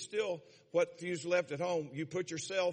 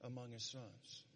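scripture reading; 4 semitones lower, 10 dB quieter than usual. Acted. Reads God's words from scripture.

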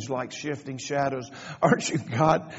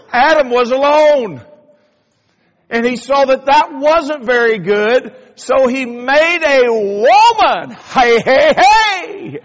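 preaching at full force; 9 semitones higher, 12 dB louder than usual. Acted. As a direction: build the joke up to a shout from the pulpit. Preacher builds to a shout.

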